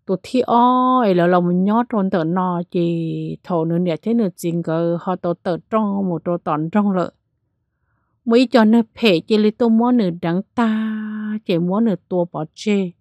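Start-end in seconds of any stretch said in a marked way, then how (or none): none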